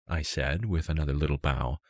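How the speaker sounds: ordinary delivery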